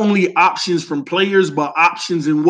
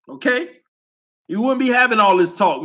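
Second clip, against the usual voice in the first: ordinary talk, nothing marked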